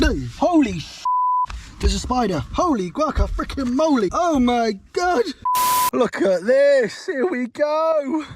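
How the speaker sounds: high startled spider shriek; screaming at a spider. Real, not acted. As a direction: squeal high and slow at it. Spots a spider.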